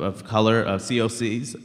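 normal talking voice

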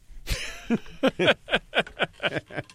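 high, sustained laugh; long run of high chuckles; laughter. Real, not acted. Reacting to his own hate-mail anecdote.

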